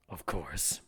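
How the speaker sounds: unvoiced whisper slipped mid-sentence; whispered aside. Villain whispering his mock deference to the council. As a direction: breathe it as a sly aside.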